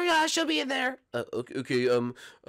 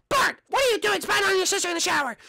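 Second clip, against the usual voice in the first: high cartoon mom voice; sing-song motherly cartoon voice; mother greeting her son. Plays Marge the mom.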